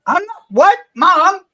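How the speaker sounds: high squeaky mock kid caught by mom; mock startled kid. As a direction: yelp like a kid busted by mom. Caught off guard, answering mom.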